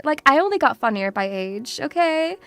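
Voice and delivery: usual speaking voice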